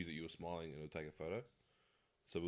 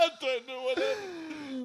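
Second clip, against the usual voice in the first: much higher, louder and slow; mock confused; 12 dB louder, slower than usual. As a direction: play dumb, drawn out. Joking mock bewildered line.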